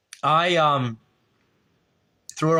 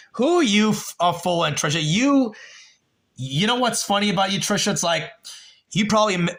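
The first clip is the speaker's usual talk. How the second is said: heated retort; 6 semitones higher than usual. Real, not acted. Heated retort at a chat viewer.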